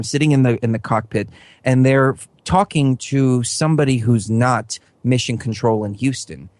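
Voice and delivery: usual speaking voice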